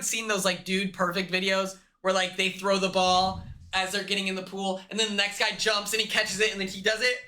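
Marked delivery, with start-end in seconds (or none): none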